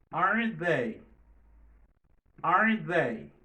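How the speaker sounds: clear, loud model phrases with a pause after each; repeat-after-me drill. Shown as a demonstration. Teacher asks students to repeat after him, loud model phrases. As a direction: say each tag question loud and clear for the class to echo. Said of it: "Repete apre mwa, sil vouplè".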